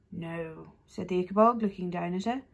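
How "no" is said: deep creature voice. A narrator's reading. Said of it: "in its deep voice".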